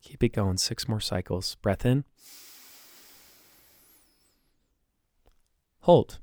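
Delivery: calm, soft breathing cues. Acted. Leads a breathing exercise.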